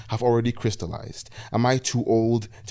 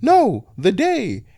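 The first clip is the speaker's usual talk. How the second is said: put-on high, slow old lady insisting; high, drawn-out elderly correction; 12 semitones higher, 6 dB louder, much slower than usual. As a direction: stretch it out like a confused old woman. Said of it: "she said".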